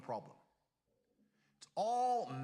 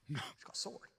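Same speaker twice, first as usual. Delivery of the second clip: normal talking voice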